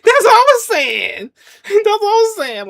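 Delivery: high, loud, through laughter; cracking up while talking; laughing agreement. Real, not acted. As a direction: laugh the words out, pitch way up.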